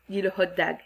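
ordinary delivery

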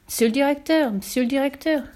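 pleading call at a door. Acted. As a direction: call urgently for the boss. Tramp calling at director's door.

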